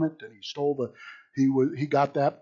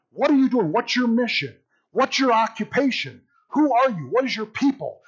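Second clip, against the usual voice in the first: higher-pitched volley of questions; insistent interrogation, pitched up; emphatic questioning; 6 semitones higher than usual. Acted. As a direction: press each question on the accused. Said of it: "They're, you know, emphasizing".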